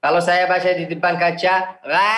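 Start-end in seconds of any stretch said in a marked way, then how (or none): 1.8-2.1 "gha" drawn out with a thin, open "aa"; thin wrong ghain. Shown as a demonstration. Teacher warning students off this mirror reading, thin ghain.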